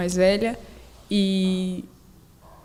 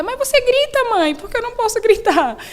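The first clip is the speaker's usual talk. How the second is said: put-on child protesting; 14 semitones higher, 4 dB louder than usual. Daughter talks back to mother.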